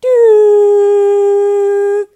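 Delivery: long, loud, high flatline beep; one held high electronic-style tone; heart monitor flatline. Retold or imitated. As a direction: imitate a flatlining monitor, piercing and sustained. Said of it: "hear from the heart monitor".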